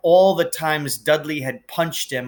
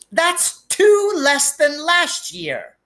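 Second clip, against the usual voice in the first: high, slow, sulky complaint; whiny Dudley; 14 semitones higher, 4 dB louder, slower than usual. A narrator's reading. Dudley complains to his parents, high slow whine. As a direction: whine it like a spoiled child cheated.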